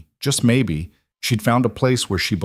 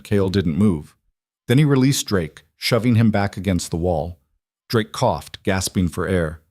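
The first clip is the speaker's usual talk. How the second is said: usual speaking voice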